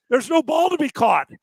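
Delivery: usual speaking voice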